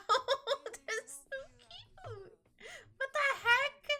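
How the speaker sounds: high, drawn-out squeal of adoration; gushing high-pitched fawning; real cuteness overload. Real, not acted. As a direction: melt into laughing, tearful delight. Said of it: "I'm gonna cry".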